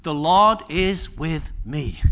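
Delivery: drawn-out, measured declaration; slow declaration. Shown as a demonstration. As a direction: say it slowly as a vow. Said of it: "this is gonna be your declaration".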